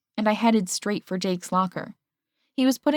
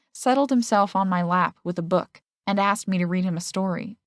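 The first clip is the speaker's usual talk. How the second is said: usual speaking voice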